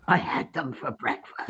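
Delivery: low, dark cannibal voice; menacing Hannibal Lecter purr; sinister low voice. Acted.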